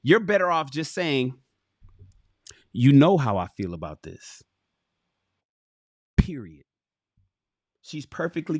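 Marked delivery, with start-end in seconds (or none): 2.7-6.6 low, settled, final statement; calm finality. Acted. Man ending the argument with his partner, calm and low. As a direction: close the matter without arguing.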